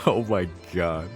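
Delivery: usual speaking voice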